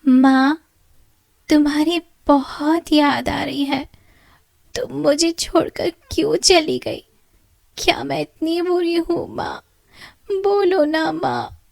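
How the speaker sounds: put-on high weeping girl, slowing at the end; crying. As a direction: cry out for her lost mother. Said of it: "रोते हुए अपनी माँ से कहती है".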